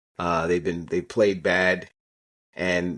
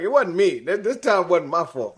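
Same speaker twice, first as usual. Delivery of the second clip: higher-pitched mock protest of innocence; retold blame-shifting; 7 semitones higher than usual. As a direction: imitate a player deflecting the blame. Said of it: "he roasted the entire freaking team".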